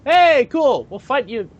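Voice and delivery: high, bright eager taunt; eager challenge. Retold or imitated. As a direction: cheerfully dare them. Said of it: "They were like".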